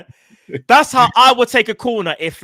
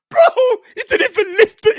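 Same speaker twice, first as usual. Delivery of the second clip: normal talking voice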